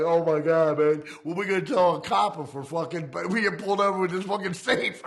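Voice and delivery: half-laughing panic about the cops. Retold or imitated. To his buddy while dragging the safe, laughing panic.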